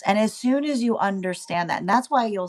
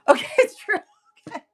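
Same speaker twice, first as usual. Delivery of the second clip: laughing it out, much higher; 11 semitones higher, 5 dB louder, faster than usual. Real, not acted. Reader laughing at her own point, laughing. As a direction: crack up while conceding. Laugh heard, high pitch.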